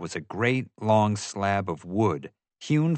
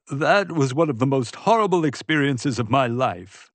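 higher-pitched, appalled character voice; exclaiming Pete; 5 semitones higher than usual. A narrator's reading. Pete recalling the horrible bear night to Ray, exclaiming. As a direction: exclaim it in an affronted, raised pitch. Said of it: "Pete exclaimed".

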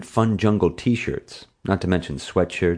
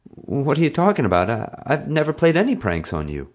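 much higher, stammering innocence; terrified denial; 8 semitones higher than usual. A narrator's reading. Frightened boy lying to the bully's face, terrified. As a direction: protest nervously in a raised pitch. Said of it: "terrified, and did my best to lie".

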